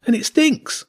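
put-on high child's voice, grossed out; disgusted kid shout. A narrator's reading. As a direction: yell it like a grossed-out boy. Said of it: "Arlo shouted".

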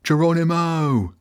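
high whooping battle cry; excited shout. A narrator's reading. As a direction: yell it as you leap through. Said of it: "One of them shouted".

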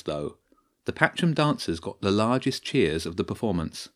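usual speaking voice

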